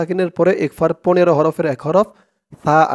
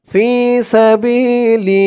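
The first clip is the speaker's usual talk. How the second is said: slow chanted tajweed reading; Quran recitation; 5 semitones higher, 4 dB louder, much slower than usual. Acted. Teacher reciting the verse for students to follow. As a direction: draw out فالذين هاجروا melodically.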